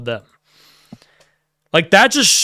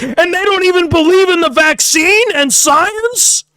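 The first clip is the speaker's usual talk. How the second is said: mock liberal; 11 semitones higher than usual. Mocking a member lambasting the group like a liberal, high indignant voice. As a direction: play an outraged liberal scolding, pitched up. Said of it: "lambasting us like a liberal".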